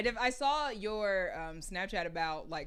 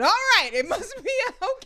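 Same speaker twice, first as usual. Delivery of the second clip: laughing high quote; 13 semitones higher, 10 dB louder than usual. Retold or imitated. Quoted own reaction, laughing.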